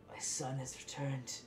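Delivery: lightly voiced father, moved but restrained; stern father softening. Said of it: "you see that tough facade crumble a bit".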